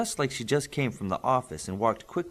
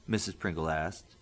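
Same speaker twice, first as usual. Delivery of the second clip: usual speaking voice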